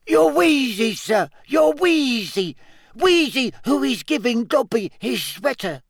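high squeaky elf voice; squeaky house-elf. A narrator's reading. Dobby voice.

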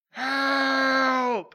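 high, loud cry of help across the mountain; desperate shout for help. Pretend. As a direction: yell it out as far as you can. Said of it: "and I'm gonna go".

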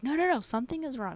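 quick, high contradiction; retold frantic denial. Wife contradicting reassuring husband, frantic and quick. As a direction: cut in fast and high, insisting.

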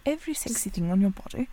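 put-on soft, breathy British accent. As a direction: say it like a posh Brit, quietly. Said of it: "just said that in a British accent".

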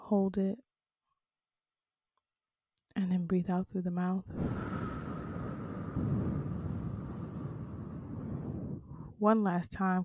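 quiet guided-breathing cue. Acted. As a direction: coach them gently through each breath. Guided breathing exercise for listeners.

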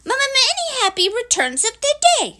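high, quick, loud little voice; squeaky small-creature greeting; nervous eager Piglet. Acted. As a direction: blurt it with a stammer, very high. Piglet repeats greeting to Eeyore.

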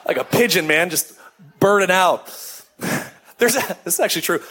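normal talking voice

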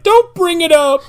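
high strained wail, slowed; crying shout. Retold or imitated. Podcast hosts acting out Fishman breaking down, high wailing shout. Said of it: "He's like".

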